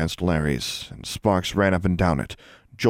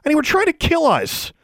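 high furious yell; 5 dB louder than usual. A narrator's reading. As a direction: yell it, outraged. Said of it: "she yelled".

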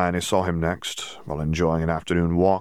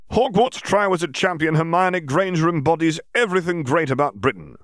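grand headline voice, pitched up; newspaper headline; 11 semitones higher, 4 dB louder than usual. Acted. Reading the Prophet's cover aloud, mock grand headline. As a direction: announce it like a tabloid banner. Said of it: "the paper read".

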